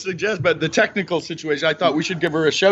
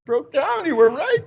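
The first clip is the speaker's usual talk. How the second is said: high-pitched, thrilled on the phone; retold excited call; 12 semitones higher, slower than usual. Son phoning mom after Embiid breaks down, excited. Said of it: "I'm like".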